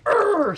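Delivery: high, squeezed "Urgh" with the whole body clenched; tense strained grunt. Shown as a demonstration. Teacher showing how tension sounds in labor. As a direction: strain it out tight. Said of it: "super tight".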